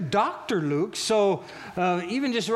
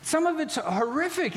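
ordinary delivery

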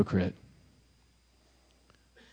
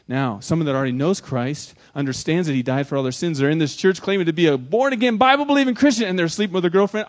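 normal talking voice

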